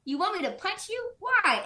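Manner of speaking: high-pitched incredulous question; imitated stunned protest, pitch jumping up; shocked disbelief. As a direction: ask it as if freaking out. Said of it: "like, super surprised".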